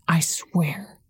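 low, unvoiced mutter under her breath. A narrator's reading. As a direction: mutter it angrily to herself. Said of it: "she muttered".

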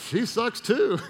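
high-pitched, playful delight at her flaw; mock glee. Acting gleeful relief at another's flaw.

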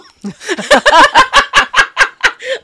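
high shrieking laugh; loud laugh. Real, not acted. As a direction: burst out laughing. Laughing at the quote.

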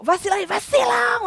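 high, shouted insult; mock shout. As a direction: bark it twice, losing control. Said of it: "ele surtou gritando vacilão". (grito imitado de vacilão)

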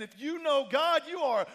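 high, loud exultant proclamation; celebrating. Acted. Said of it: "again, he's celebrating".

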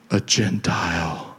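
very low, slow creepy voice. Shown as a demonstration. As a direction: drop it deep and draw it out creepily. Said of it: "use that creepy voice".

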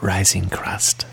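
slow drawn-out whisper; ASMR whisper. Pretend. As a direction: whisper it slowly, savoring. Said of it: "a little A S M R".

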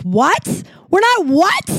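can't believe the number; incredulous. Retold or imitated. Coworker's guess, answered with shocked disbelief. Baffled by wild earnings guess.

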